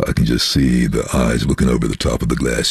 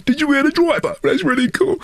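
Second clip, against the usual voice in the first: high-pitched lady voice. Retold or imitated. Ladies on the train quoted.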